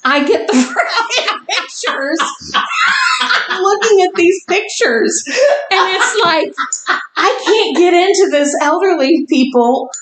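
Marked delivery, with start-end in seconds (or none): 0.0-8.5 high, breaking up with laughter; laughing. Real, not acted. Co-host cracking up over the pictures, laughing as she talks. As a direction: struggle to get the words out through laughter.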